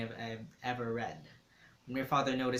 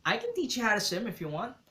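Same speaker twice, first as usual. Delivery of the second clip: higher, lightly voiced boy with a cold; a touch of a voice about to go; lightly voiced teen boy; Dante's soft raspy voice; 10 semitones higher, 4 dB louder than usual. A narrator's reading. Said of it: "I kinda liked his voice".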